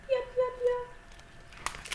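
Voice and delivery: high, slow chick peeps; playful high-pitched chick imitation; chick cheeping.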